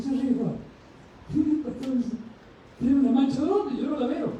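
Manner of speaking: high, incredulous questioning; mock surprise, pitched well up; puzzled disbelief. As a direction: act baffled, as if there's nothing on your face. Preacher acts puzzled self at mirror.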